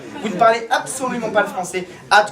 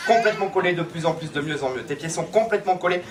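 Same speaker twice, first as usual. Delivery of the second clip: softer, lower, sinking hypnotist voice; hypnotic suggestion; 4 semitones lower, 4 dB quieter than usual. Acted. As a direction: lull her deeper into the trance.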